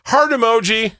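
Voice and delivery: high, sing-out mock texter; mock chirpy reply. Mimics a dismissive texted reply.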